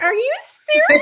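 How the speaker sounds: very high, loud squeal of disbelief; high-pitched excited disbelief; shocked squeal. Retold or imitated. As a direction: squeal it like you can't believe it. Retelling her own shocked reaction.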